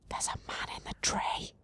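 hushed whisper; whispered surprise at the man in the tree. Real, not acted. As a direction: whisper it like a secret. Whispered aside in game.

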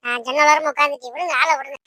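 ordinary talk, nothing marked

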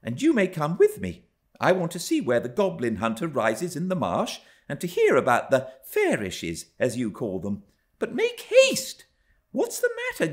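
put-on high girlish bossiness; imperious young girl. A narrator's reading. Young Cathy bossing Hareton to come along, high imperious voice. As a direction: command like a spoilt child, pitched high.

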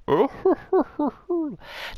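a high, theatrical cackle falling away; high hammed-up villain laugh. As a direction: cackle like a cocky rival. Villain character laughs on meeting.

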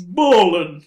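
loud, punchy hype shout. Shown as a demonstration. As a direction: sell it like a rapper. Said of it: "change awesome to ballin'".

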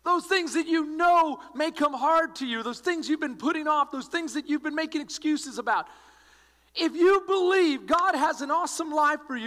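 rally the congregation to act now; preaching at full force. Acted. Pastor urging his church to act, preaching at full force.

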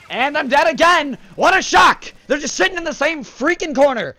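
high, loud frustrated outburst; exasperated rant. Real, not acted. Player ranting about campers after dying, high and loud.

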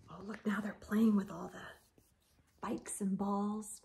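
hushed picture-book aside; whispered aside. Real, not acted. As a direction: lean in and whisper about the picture.

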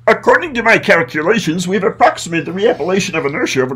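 high, fast sci-fi alien babble. Retold or imitated. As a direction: rattle it off like a robotic space alien. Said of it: "does anyone have an alien voice".

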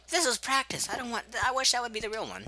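high loud whining; high whine. Real, not acted. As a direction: whine in protest. Upset it was practice.

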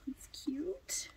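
soft unvoiced whisper, cooing; whispered admiration. Real, not acted. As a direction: breathe it out in awe.

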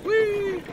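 very high, loud squeal; playful whee. Real, not acted. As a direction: let out a high, sustained whee. Playful squeal during the chase.

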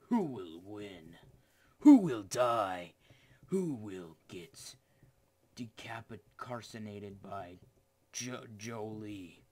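slow, mock-epic announcer; dramatic announcer. Streamer hyping blue versus red, slow dramatic announcer. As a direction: stretch each question out dramatically.

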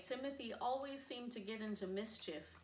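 usual speaking voice

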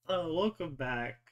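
ordinary delivery

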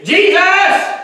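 very high, loud desperate cry; calling out. Retold or imitated. Beggar calling louder.

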